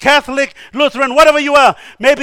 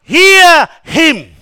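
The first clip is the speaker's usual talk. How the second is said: loud, high, drawn-out command; booming divine order; commanding voice of God; 5 semitones higher, 7 dB louder than usual. Retold or imitated. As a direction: proclaim HEAR HIM forcefully from heaven. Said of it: "And the next word says what?".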